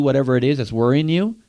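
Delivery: usual speaking voice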